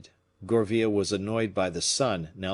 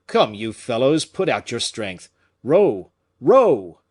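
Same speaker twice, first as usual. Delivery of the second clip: urging the rowers; 3 semitones higher, 9 dB louder, slower than usual. Acted. Captain urges on the rowers.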